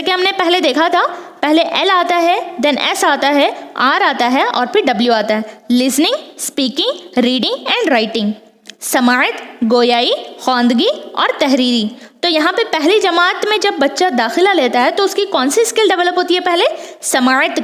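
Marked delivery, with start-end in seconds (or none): 5.7-11.9 slow deliberate listing. Shown as a demonstration. Teacher spells out the four skills.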